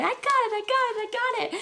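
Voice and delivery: very high squealed chant; squeaky mock excitement; excited announcing. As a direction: imitate friends bursting with good news. Friends announcing tickets, retold.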